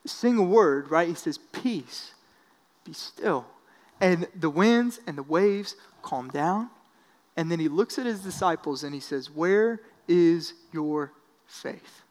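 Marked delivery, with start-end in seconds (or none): 1.4-3.5 soft, hushed and steady; Jesus speaking quietly over the wind and waves; calm command. Retold or imitated. Jesus calms the storm. 9.3-12.0 slow, soft and searching; Jesus gently questioning his frightened disciples; gentle rebuke. Retold or imitated.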